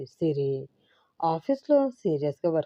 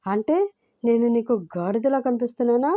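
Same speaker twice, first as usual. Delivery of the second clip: shouting; 6 semitones higher than usual. A narrator's reading. Husband yells at his wife. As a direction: yell at being called a donkey. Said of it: "అరిచాడు సిద్ధు".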